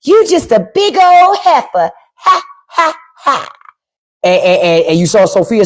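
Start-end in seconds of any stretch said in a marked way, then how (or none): 0.0-1.9 movie lady impression. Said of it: "when that lady said". 2.2-3.5 high, separated fake ha-has; three spaced, high staged laughs; mock taunting laugh. Lady laughing at Sophia, high mocking ha-has.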